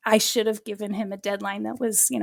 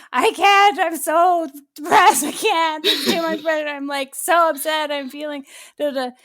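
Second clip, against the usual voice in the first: voicing the son's late-night panic, much higher and louder, dragging out the despair; a hammed-up plea, falling apart over text; mock distressed wail; 4 semitones higher, 5 dB louder than usual. Son texting in distress late.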